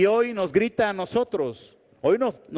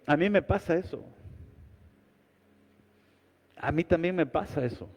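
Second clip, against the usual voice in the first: quiet confession; 3 semitones lower, 7 dB quieter, faster than usual. Acted.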